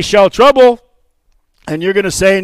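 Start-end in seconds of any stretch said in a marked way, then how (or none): 0.0-0.8 loud, rising call; call for response. Shown as a demonstration. Pastor shows the call before the congregation answers, loud. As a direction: throw the question out to the crowd. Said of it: "I'm gonna say".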